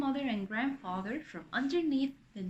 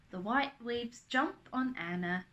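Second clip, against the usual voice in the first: ordinary delivery, nothing marked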